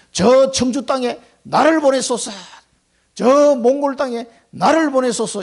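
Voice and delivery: 간절하게 부르짖듯; fervent prayer. Acted. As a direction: cry out to God to send you. Acted prayer of commitment.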